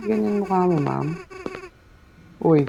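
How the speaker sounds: ordinary talk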